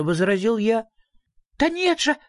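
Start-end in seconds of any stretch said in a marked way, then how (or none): 1.6-2.2 put-on very high, thrilled little girl; piping child's glee; delighted exclamation. A narrator's reading. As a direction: burst out in delight, pitched high. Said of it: "восхищённо воскликнула дочь Мимлы".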